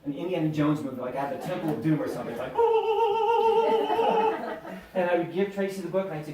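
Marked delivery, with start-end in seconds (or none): 2.6-4.9 high squealing laugh; big high real laugh; loud laugh. Real, not acted. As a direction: burst out laughing. Laughs at Indiana Jones image.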